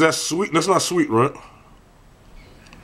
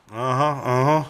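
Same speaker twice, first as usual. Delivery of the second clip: talking while chewing; words round a mouthful of chewing; chewing steak; slower than usual. Real, not acted.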